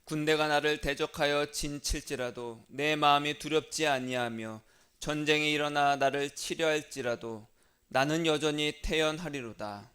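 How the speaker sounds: loud, measured psalm recitation; solemn liturgical reading voice; scripture reading. Acted. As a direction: proclaim the psalm to the congregation.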